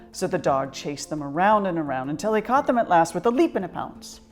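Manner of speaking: ordinary delivery, nothing marked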